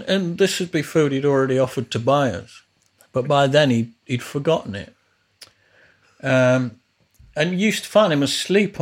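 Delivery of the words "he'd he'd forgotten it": ordinary talk, nothing marked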